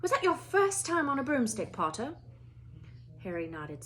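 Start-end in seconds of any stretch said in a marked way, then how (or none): 0.0-2.2 brisk, higher, stern Scottish teacher; clipped headmistress asking politely; a stern older Scottish witch at a classroom door; McGonagall voice. Acted.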